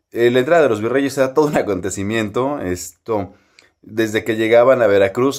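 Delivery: ordinary delivery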